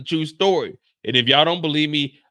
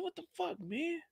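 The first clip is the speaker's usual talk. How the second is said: acted bewilderment; 14 semitones higher, 14 dB quieter than usual. Startled character opens the door.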